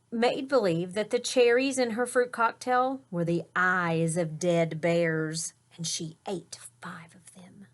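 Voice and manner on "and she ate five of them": whisper. A narrator's reading. Spooky story detail, whispered.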